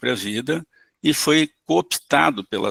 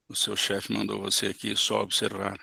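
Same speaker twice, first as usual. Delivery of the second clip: quiet, gentle echo; calm soft mirroring; 2 semitones lower, 8 dB quieter than usual. Shown as a demonstration. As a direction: repeat it back softly and calmly. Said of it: "com calma".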